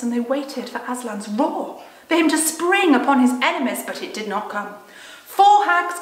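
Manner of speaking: put-on expressive read-aloud to children; storybook narration; story reading. A narrator's reading. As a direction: read the story with feeling, letting the scene build.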